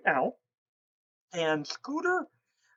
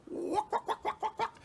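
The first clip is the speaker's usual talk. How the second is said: high breathy owl chirps; owl contented chitter; 13 semitones higher, 6 dB quieter than usual. Retold or imitated. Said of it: "he'll go".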